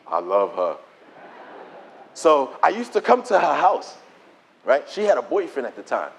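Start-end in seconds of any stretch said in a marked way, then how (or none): 0.1-0.8 very low-pitched confession of love; deep, understated lovestruck aside; smitten aside. Retold or imitated. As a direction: drop right down for a private admission. Retold smitten inner thought.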